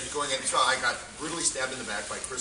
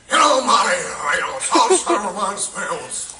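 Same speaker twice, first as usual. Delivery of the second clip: loud, higher hammed-up impression; stock impression voice; 6 semitones higher, 9 dB louder than usual. New impressionist doing his stock impression, hammed-up and loud. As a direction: belt out a clichéd impression. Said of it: "my career starts with".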